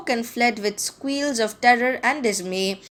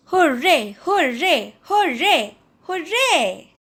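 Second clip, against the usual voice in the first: rising, ever higher cheers; cheering crowd; 5 semitones higher, slower than usual. A narrator's reading. Weasels cheering at their feast, building excitement. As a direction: swell each hooray like a rowdy crowd.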